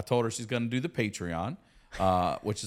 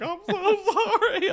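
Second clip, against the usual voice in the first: very high, loud blubbering imitation; mock crying; 6 dB louder than usual. Comic imitating crying audience member apologizing to him, mock crying. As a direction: squeal it like a hammed-up weeping apology. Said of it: "And she's crying".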